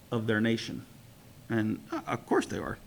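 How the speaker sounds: ordinary talk, nothing marked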